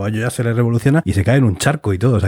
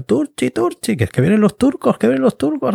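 much higher panicked yelling of the alarm; panicked cry; 14 semitones higher than usual. Retold or imitated. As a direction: raise the alarm, high and frantic.

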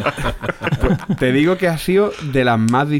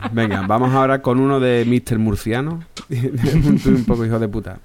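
ordinary talk

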